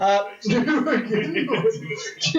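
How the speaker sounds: high, slow, laughing echo. Acted. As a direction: laugh while repeating it. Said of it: "echoed line, the second take laughing".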